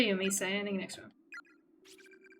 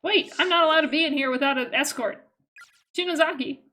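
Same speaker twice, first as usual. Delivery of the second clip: high, raised-voice objection; urgent protest; 5 semitones higher, 6 dB louder than usual. Acted. Game character calls after Shinozaki, protesting urgently. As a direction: call out urgently after someone.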